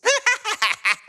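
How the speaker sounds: high gleeful cackle. Acted. Rocket reading the prank recipe, gleeful high cackle. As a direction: cackle like a villain who found the perfect plan. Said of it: "who looks at it and cackles".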